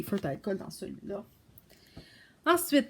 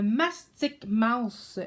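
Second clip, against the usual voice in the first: normal talking voice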